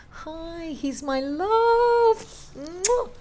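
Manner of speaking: high, slow, doting pet talk; cooing at the dog. Real, not acted. Owner cooing high and slow to her beloved dog.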